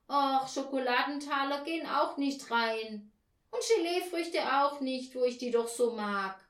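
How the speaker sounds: put-on high, loud child's whine; disappointed child. A narrator's reading. Child laments to Mama about sweets, plaintive high voice. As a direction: complain like a let-down child.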